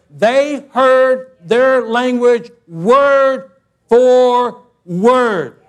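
"word Four word" drawn out, each word loud and separate; emphatic word by word. Real, not acted. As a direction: hammer "word Four word" one slow word at a time. Stressing literal translation.

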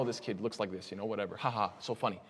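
low, soft offhand remark; mock careless joking; flippant. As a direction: toss it off as if it were nothing. Mimics a careless passing remark.

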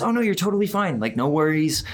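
high-pitched, airy 'no problem' voice; sing-song mock reassurance; breezy reassurance. Boss brushing off a mistake.

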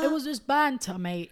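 lightly hammed-up British lad shrugging it off; mock British bloke. Mocking a guy defending his joke.

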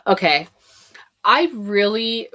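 normal talking voice

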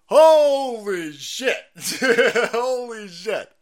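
loud, slow shocked exclaim; stunned disbelief. Real, not acted. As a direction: gasp it out in shock. Shocked reaction to the clip.

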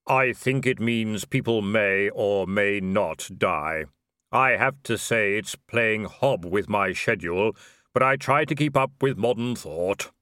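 slow, sombre otherworldly voice; Death. Acted. Robed figure explains the principle to Vimes, slow solemn voice. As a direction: intone it slowly and evenly.

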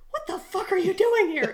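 high, loud, laughing shock; shocked shout. Retold or imitated. Soldier stunned to see his friend in Vietnam. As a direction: shout it in disbelief. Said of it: "He's like".

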